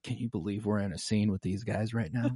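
imitating a hushed whisper of disbelief; hushed aside. Actor leans to costar mid-scene, hushed whisper. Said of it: "whispering inconsequential shit to each other".

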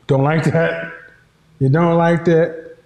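ordinary talk, nothing marked

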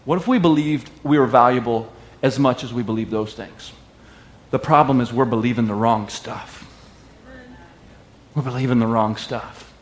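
usual speaking voice

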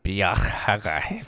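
Biyah Haggai said slowly with a throaty h in Haggai; slow, careful Hebrew: Biyah Haggai. Shown as a demonstration. Reading the Hebrew title aloud to his co-host, slow and careful. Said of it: "by the hand of Haggai".

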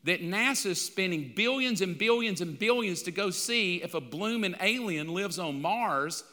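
ordinary talk

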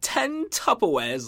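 slow, high mock British accent; over-the-top fake British. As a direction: drawl it like a posh Brit. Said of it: "in a fucking British accent".

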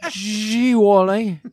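put-on high and loud gee-whiz kid; Beaver impression. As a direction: belt it like a fifties kid. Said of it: "too many crossovers in one impression".

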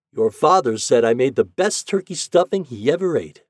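grandmother's contented pride; satisfied. A narrator's reading. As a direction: boast mildly, pleased with your own cooking. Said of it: "she said with satisfaction".

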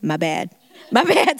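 a sheepish apology that breaks into a laugh. Pretend. [name] caught out after swinging, laughing sheepishly. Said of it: "I'd be like".

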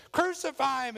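high, loud mob cry; crowd's shout. Acted. Crowd demanding crucifixion from Pilate, loud yell. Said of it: "the crowd says".

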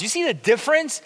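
ordinary talk, nothing marked

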